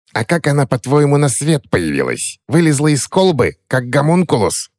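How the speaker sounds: high sarcastic hag voice; sarcastic old witch; mocking crone. Witch sneers at the boy's naivety, high and sarcastic.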